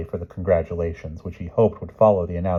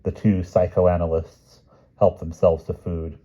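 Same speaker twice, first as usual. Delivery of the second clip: ordinary delivery, nothing marked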